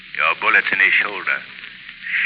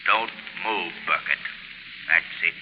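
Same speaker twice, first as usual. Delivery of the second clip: low, soft, commanding voice; will him into a trance; hypnotic command; 5 semitones lower, 12 dB quieter, slower than usual. Acted. The Shadow hypnotizing Burkett, low and soft.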